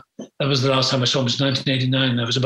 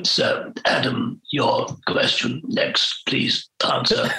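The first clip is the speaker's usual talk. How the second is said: put-on slow, whispered, strained old judge; Jack Hawkins' voice; much slower than usual. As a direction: croak it out slowly, like a man after a tracheotomy. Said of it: "he had a particular voice".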